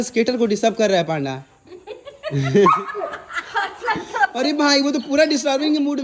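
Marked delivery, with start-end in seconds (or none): 1.7-4.3 high burst of laughter; real amused laugh; big laugh. Real, not acted. Laughing at panda disturbing skater.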